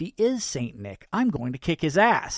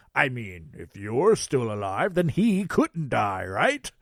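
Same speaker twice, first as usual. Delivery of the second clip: Santa voice; 5 dB louder than usual. Acted. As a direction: play a cheerful Santa. Santa speaks.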